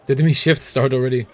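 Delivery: ordinary talk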